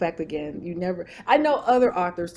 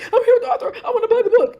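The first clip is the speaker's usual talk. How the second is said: loud, high-pitched gushing through laughter; excited fan; 13 semitones higher, 7 dB louder, much faster than usual. Retold or imitated. Fan telling husband she's with the author, mock frantic excitement. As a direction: imitate a thrilled fan insisting, voice pitched way up.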